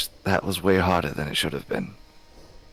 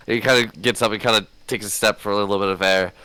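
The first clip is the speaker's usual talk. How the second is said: ordinary talk, nothing marked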